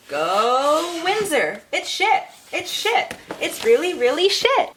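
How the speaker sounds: high, loud sarcastic chant; hammed-up rally cry; mock hometown cheer. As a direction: cheer for the town while trashing it. Joking cheer about her hometown.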